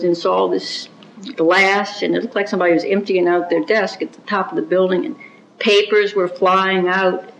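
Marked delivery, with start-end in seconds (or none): none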